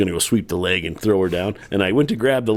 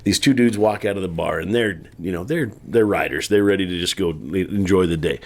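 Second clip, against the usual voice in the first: ordinary delivery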